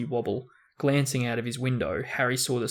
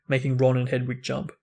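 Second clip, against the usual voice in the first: usual speaking voice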